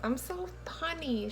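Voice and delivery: corny. Real, not acted. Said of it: "She's so corny".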